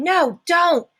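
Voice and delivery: high, urgent cry; scream. A narrator's reading. Annabeth cries out to stop Percy looking, a high scream. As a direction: scream it to stop him in time. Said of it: "Annabeth screamed".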